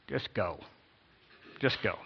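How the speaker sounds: low, soft and falling; two subdued, dropped phrases; resigned reading. Acted. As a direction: give permission with no enthusiasm. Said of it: "how this is supposed to be read".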